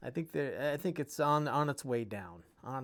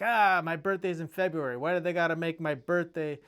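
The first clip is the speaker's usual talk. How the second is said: lightly voiced complaining dad; 3 semitones higher, 7 dB louder than usual. Son retelling dad's birthday complaint, mildly raised mock griping. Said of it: "my dad would be like".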